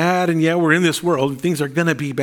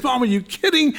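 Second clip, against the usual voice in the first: incredulous; 7 semitones higher than usual. Retold or imitated. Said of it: "I said".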